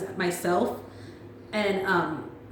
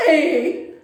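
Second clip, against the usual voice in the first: playful shout; 6 semitones higher, 6 dB louder than usual. Retold or imitated. Greeting Erica's group, laughing.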